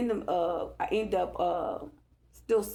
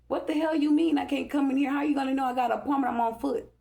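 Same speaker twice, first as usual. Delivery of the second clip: indignant outburst; 6 semitones higher, faster than usual. Real, not acted. Refused entry by the old lady, indignant and fast. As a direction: fire back in disbelief, fast.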